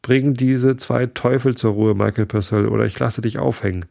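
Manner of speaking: ordinary delivery, nothing marked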